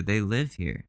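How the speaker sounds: normal talking voice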